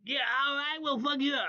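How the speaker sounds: high, mock-menacing broken-English voice; deadly earnest broken-English caricature; super serious broken English. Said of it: "super serious, a little midget. He was just like".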